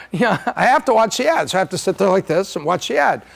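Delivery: ordinary talk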